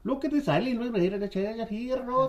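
high, silly Irish leprechaun voice; mock Irish accent. Shown as a demonstration. Pirate showing off the treasure island, high Irish accent. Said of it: "Can you do an Irish accent".

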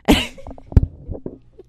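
loud airy burst of laughter; loud breathy laugh. Real, not acted. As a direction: cackle out loud at yourself. Laughing after rant.

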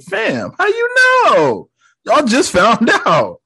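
high, drawn-out disbelief; slow, high-pitched mock bafflement; incredulous reaction. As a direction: squeal it in comic outrage. Acting own incredulous reaction.

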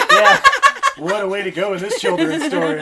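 high, loud laughter through the words; real laugh breaking into speech. Real, not acted. Laughing at the tree joke.

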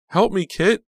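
very high, loud plea; desperate cry for help. A narrator's reading. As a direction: call out helpless and panicked. Collapsed heroine begs for rescue.

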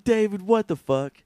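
loud, high exasperated scold; hammed-up outrage at a child; mock scolding. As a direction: imitate a parent at wit's end. Voicing adults scolding David.

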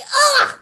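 high startled shriek; imitated gasp of horror; mock shriek. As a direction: let out a sudden shocked squeal. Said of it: "And Lizzie's like".

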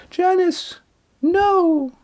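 very high mock scream at normal volume; shrill scream. A narrator's reading. Bronwyn cries out from the earthworks, shrill voiced scream. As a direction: hold a shrill, high-pitched cry. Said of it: "Bronwyn screamed again".

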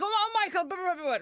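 high-pitched mimicry of an over-familiar man; mock gushing greeting. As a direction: rattle it off high, imitating him. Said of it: "he's like".

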